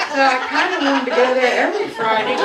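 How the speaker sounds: fast, loud and high through laughter; laughing while talking. Real, not acted. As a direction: push the words out while cracking up.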